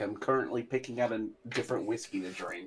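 distant, off-mic voice; talking away from the microphone; far from mic. Real, not acted. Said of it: "Sorry if I sound far away from the mic".

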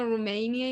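"Rumania" said the English way, roo-MAY-nee-a; English pronunciation. Shown as a demonstration. Explaining her doubt to friends, English-style "Rumania" with MAY stressed. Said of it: "lo escucho en inglés y es como".

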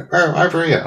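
high, squawky cartoon crow; Banana Man crow. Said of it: "crow sound effects come from the crow".

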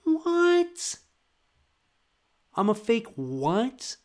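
very high, squeaky disbelief; hammed-up indignant what; mock outrage. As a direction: squeak out offended shock. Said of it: "I'm like".

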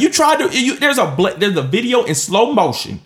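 high, loud, worked-up accusation; fired-up scolding of the player; heated rant. Real, not acted. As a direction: call him out, voice rising.

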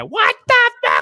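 shrieked very high, mom losing it; mom screaming. Retold or imitated. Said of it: "I can hear my mom screaming".

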